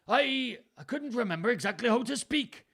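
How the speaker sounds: very high, loud joking Welsh; mock Welsh accent. Reader excuses the slip as Gloucester, mock Welsh accent. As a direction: explain it in the character's hammed-up Welsh. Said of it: "trying to do a Welsh accent".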